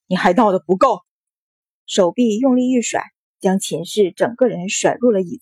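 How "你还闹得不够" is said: stern rebuke, only mildly raised; a restrained angry scolding; stern shout. A narrator's reading. Husband dragging his wife back, stern shout. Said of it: "喝道".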